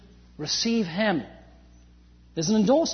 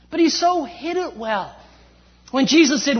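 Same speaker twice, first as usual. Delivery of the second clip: ordinary talk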